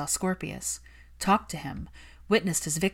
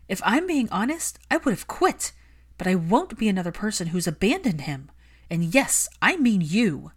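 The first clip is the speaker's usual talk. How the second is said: higher, louder furious plea; angry Hermione; 4 semitones higher, 5 dB louder than usual. Acted. Hermione scolding Malfoy for neglecting his son, heated. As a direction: defend the lonely child fiercely.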